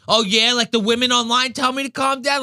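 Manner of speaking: high, mock-indignant comeback; imitated angry retort. Pizzeria guy answering someone who said calm down, high and indignant. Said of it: "mad because he's".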